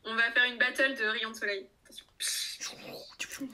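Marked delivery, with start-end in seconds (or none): 1.8-3.5 slow mock whisper; whispered warning. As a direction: breathe it like a threat.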